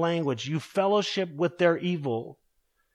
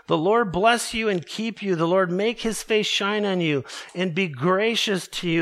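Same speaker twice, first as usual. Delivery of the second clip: raised, solemn blessing over the people; benediction; 4 semitones higher, 3 dB louder than usual. Acted. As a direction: bless them with lifted voice. Pronounces benediction over congregation.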